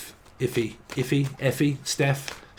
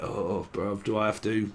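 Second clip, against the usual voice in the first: much softer, lower grudging plea; reluctant whine; 3 semitones lower, 6 dB quieter than usual. Retold or imitated. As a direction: plead quietly, dreading the cuddle. Acting a reluctant reply.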